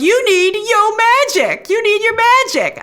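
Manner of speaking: high, loud, drawn-out mock urging; hammed-up high cartoon urging; urgent shark pitchman. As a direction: play the shark pushing magic on someone, loud and high. Voices the shark urging Billy.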